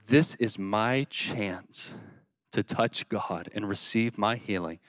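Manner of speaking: low, soft and slow; hushed resolve. Acted. Blind man realizing his chance to touch God, hushed resolve. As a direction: drop down and weigh each word quietly. Acted inner resolve of Bartimaeus.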